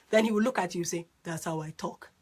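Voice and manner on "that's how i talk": low, soft imitation of a quiet man; quiet talker. Wife imitating her quiet husband's reply, low and quiet. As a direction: murmur it back, much lower and softer. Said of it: "he would talk quietly".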